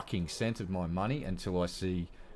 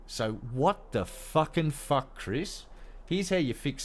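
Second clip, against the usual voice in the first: exasperated; 7 semitones higher, slower than usual. Real, not acted. Exasperated rant at developer.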